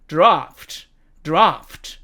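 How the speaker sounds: British "draft" with long ah; British demo of "draft"; British accent. Shown as a demonstration. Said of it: "영국식 발음으로 읽으면".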